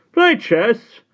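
high, loud scornful sneer; mock the ragged boy; sneering gatekeeper. A narrator's reading. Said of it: "the gatekeeper sneered".

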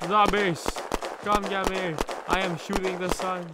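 loud high taunting yell; shouting. Pretend. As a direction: call out to the zombies. Calling zombies to attack.

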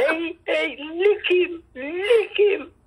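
high excited mock cheer, laughing; retold cheering. Neighbours cheering as she beats him, high laughing cheer. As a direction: squeal it like the neighbours did.

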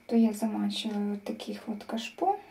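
ordinary talk

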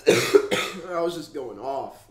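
slow and loud, pressing on each word. Real, not acted. Telling viewers how he raged earlier. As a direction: lean hard into it to show how upset he was. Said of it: "You guys should have heard me".

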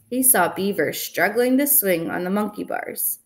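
plain reading voice

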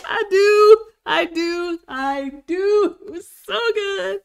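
high, loud, emotional cry; wanting to cry. Real, not acted. Vlogger overcome after the perfect bite. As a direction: let the joy spill into tears. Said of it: "I wanna cry".

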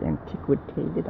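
"Antiquated" said slowly and softly, rising like a question; tentative "Antiquated" with a questioning rise; unsure try at a word. Real, not acted. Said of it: "I can't pronounce the other word".

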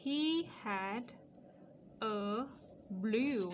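"He had", pause, "a", pause, "blue"; spaced-out, careful model reading; slow dictation. Shown as a demonstration. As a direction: dictate in separate pieces for writing. Dictating the sentence word by word.

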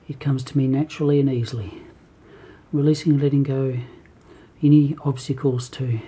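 low, steady affirmations as if for the cat; affirmation recital. Acted. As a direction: recite self-help affirmations calmly.

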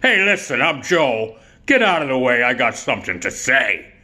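brash attention-grabbing buddy voice; Joe Donatello. Acted. Buddy acts like he owns the room, brash impression. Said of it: "That's the way Joe talked".

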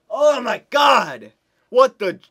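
high, loud, stretched out cry of disbelief; shocked shout. Real, not acted. Gamer shouting in shock at the opponent's goal. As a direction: yell it as the goal goes in.

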